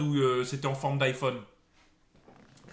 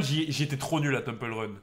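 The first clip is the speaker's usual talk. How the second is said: ordinary talk, nothing marked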